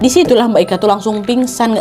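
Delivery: plain reading voice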